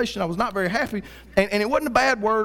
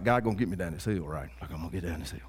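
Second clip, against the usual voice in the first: hushed faith; 12 semitones lower, 8 dB quieter, faster than usual. Retold or imitated. Self-assuring faith, whispered.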